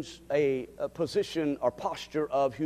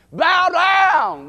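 much higher, much louder shout of bow down; shouted command; 14 dB louder than usual. Real, not acted. Preacher shouting the command to his congregation. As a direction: bark it out to the whole church. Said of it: "God wants us to bow down".

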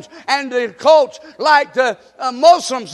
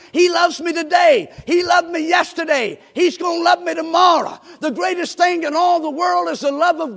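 higher shouted preaching, rising fervor; preaching at full force; 3 semitones higher than usual. Acted. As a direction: preach it out to the congregation. Preacher builds on God's love.

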